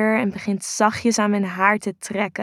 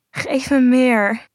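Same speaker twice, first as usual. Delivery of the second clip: louder, higher, drawn-out demand; urgent cry; 4 semitones higher, 5 dB louder, slower than usual. A narrator's reading. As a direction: cry it out slowly, pleading for it. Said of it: "dat ik wil schreeuwen".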